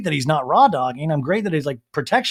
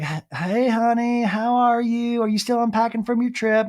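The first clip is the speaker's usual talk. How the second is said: Taylor's mom; 7 semitones higher than usual. Retold or imitated. Mom phones her daughter after a trip, high-pitched mock mom. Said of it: "gets a phone call from her madre".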